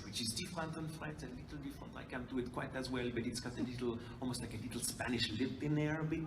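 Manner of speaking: soft, rough attempt at a Quebecois accent. Shown as a demonstration. Describing the handler's accent by attempting it, soft Quebecois accent. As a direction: lightly imitate Quebecois French-accented English. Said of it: "gorgeous Quebecois accent".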